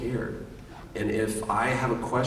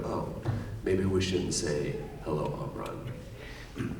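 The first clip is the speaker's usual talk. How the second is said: slow, much softer, half-whispered; mock hushed doubt; 5 semitones lower, 7 dB quieter, much slower than usual. Actor mocking his own line, slow and hushed. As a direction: drop to a breathy hush, slowly. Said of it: "when we go".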